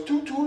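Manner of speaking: high tooting; mock boat horn. The boat leaving, high mock horn. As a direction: toot like a ship's horn. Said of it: "the boat goes".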